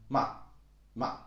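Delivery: "ma" short and light, with no tone; unstressed neutral-tone "ma"; toneless. Shown as a demonstration. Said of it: "just toneless".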